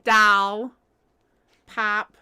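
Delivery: a hint of Michigan vowels; Michigan accent. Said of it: "that Michigonian accent".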